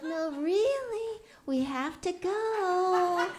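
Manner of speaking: slow, high, drawn-out insisting; firm mom. Retold or imitated. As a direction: stretch it out, pleading with the kid.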